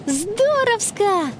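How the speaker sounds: put-on high, drawn-out girlish cheer; delighted exclamation. Girl settles into departing train, slow high delighted exclamation. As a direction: stretch the word out with delight.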